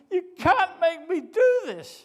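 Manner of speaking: high, loud, slow cry of disbelief; shocked protest. Acted. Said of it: "unbelievably shocked".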